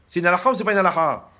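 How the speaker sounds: put-on old wife voice. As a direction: snap at the rabbi like an impatient grandmother. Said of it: "vous devez susciter le besoin".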